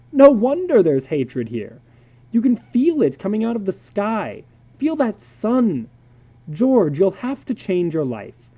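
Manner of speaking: a hint of a blunt psychologist warning his friend; earnest psychologist. Psychologist David to George, a lightly voiced urgent warning.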